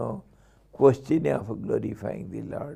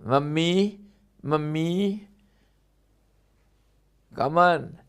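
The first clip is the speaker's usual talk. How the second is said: higher, sing-song coaxing voice; coaxing parent; 6 semitones higher, 5 dB louder than usual. Retold or imitated. Parent playfully coaxing the baby to speak, sing-song. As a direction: tease the baby with a silly word.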